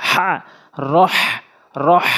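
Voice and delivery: রহ said with Arabic ḥa; heavy throat ha. Shown as a demonstration. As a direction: push the হা in রহ from the middle of the throat. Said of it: "বড় হা এনে উচ্চারণ করতে হবে হলকের মাঝখান থেকে".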